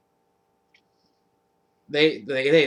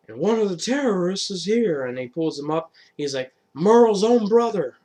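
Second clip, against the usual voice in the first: dramatic reveal; 6 semitones higher, 5 dB louder than usual. Retold or imitated. Governor revealing Daryl to the crowd, building louder.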